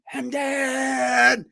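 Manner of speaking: high, drawn-out yelled name; full-heart stadium holler; imitated loud cheer. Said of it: "loud cheer".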